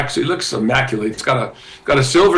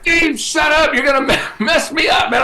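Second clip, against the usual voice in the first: laughing protest; 12 semitones higher than usual. Retold or imitated. Telling Dave off mid-song, laughing.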